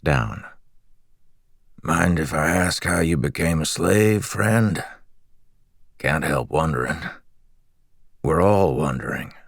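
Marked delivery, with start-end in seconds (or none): 1.8-9.3 lightly raspy, friendly prisoner; a hint of rasp, curious and chatty; raspy slave voice. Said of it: "He had a raspy voice".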